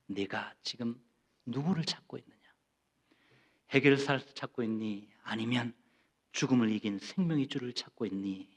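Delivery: soft, low, unhurried questions; tender voice of Jesus. Retold or imitated. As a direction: gently ask the congregation. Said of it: "동일하게 말씀하십니다". (성도에게 조용히 묻는 주님, 다정하게)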